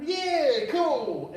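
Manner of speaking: high, loud retold cheer; keen agreement. Agreeing to get drunk online, excited.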